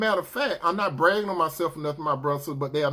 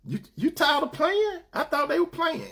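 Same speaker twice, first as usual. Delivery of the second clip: high voice; 11 semitones higher than usual. Retold or imitated. Woman returns and sits.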